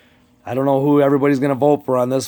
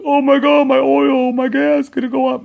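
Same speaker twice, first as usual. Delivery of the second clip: mocking a panicked citizen in a much higher voice; panicky public; 11 semitones higher than usual. Mimicking scared people reacting to gas prices in a high, panicked voice. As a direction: squeal it like a frightened consumer at the pump. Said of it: "They don't want people to be like".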